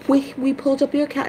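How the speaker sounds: high, fast mock phone agent voice; high mock customer service voice; mimicking bank agent. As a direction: imitate the bank rep, chirpy and high. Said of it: "they're like".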